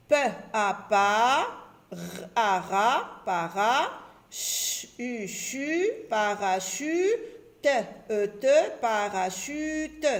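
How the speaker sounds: stretched letters and syllables blending into PARACHUTE; syllable-by-syllable reading. Shown as a demonstration. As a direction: slowly sound out PARACHUTE, holding each vowel. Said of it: "on lit les deux syllabes". (déchiffrer lentement PARACHUTE, syllabe par syllabe)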